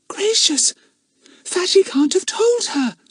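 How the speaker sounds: breathy stage whisper; loud whisper. A narrator's reading. Bets whispering to the others so the mother won't hear. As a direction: whisper it urgently but audibly. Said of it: "in a loud whisper".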